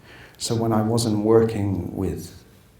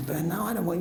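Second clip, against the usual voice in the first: ordinary delivery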